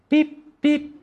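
short high-pitched beeps; imitated warning beep. Petrol light comes on while driving home, imitated high beep. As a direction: mimic a dashboard alert, high. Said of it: "the petrol light comes on".

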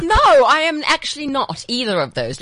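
loud, high correction; indignant correction. Real, not acted. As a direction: set them straight, mock-offended. Correcting the joke loudly.

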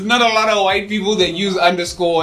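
talking through laughter; laughing. Real, not acted. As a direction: chuckle through the words.

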